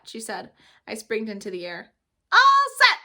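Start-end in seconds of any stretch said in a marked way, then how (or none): 2.3-3.0 much higher, loud joyful holler; a child whooping with joy. Acted. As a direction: holler it out with delight. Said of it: "I hollered real joyful".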